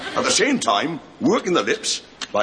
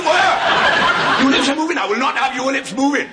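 screaming; 5 dB louder than usual. Real, not acted. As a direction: scream the order. Sergeant major screaming at recruit.

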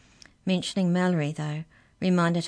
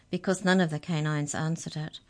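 ordinary delivery, nothing marked

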